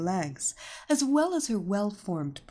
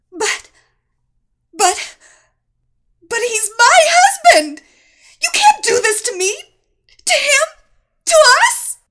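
hysterical shouting; 15 dB louder than usual. Acted. Wife pleads in shock.

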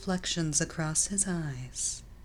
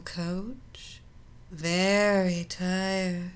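seductive hypnotic voice; slower than usual. A narrator's reading. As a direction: lull him to sleep with your voice. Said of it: "entrancing, seductive voice".

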